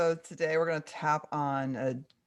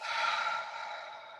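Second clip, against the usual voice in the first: long audible breath out; deep exhale; 4 dB quieter than usual. Real, not acted. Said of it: "take a deep breath".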